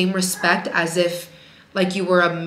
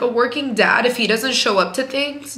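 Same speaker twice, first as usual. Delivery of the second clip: usual speaking voice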